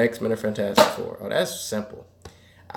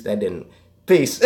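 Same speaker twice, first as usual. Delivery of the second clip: ordinary delivery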